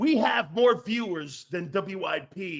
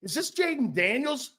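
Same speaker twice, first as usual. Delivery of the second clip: ordinary talk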